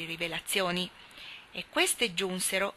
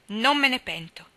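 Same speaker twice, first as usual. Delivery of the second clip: defiant; 4 semitones higher, 6 dB louder than usual. A narrator's reading. Defiant boy confronted.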